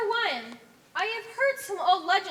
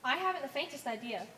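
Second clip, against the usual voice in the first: ordinary talk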